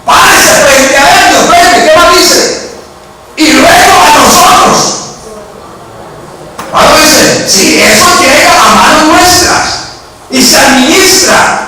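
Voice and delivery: high, shouted scripture exhortation; impassioned preaching. Real, not acted. Preacher citing Paul to the congregation on offerings, impassioned preaching. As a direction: call out each phrase high, with preaching force.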